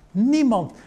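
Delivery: a sudden high-pitched Niemand; Niemand, high and hard; emphatic. Acted. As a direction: shoot Niemand up high in disbelief. Silence after the resurrection.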